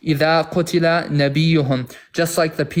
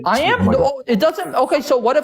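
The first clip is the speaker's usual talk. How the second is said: exasperated shout in a heated debate; heated outburst; 9 semitones higher than usual. Real, not acted. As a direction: cut in over everyone, exasperated. Shouting over crosstalk in argument.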